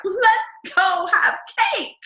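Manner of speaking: high, drawn-out happy cheer; mock giddy excitement. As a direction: act out being really happy, pitched high and slow. Acting out being really happy.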